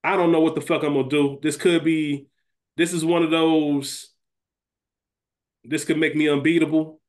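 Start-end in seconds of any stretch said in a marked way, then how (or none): none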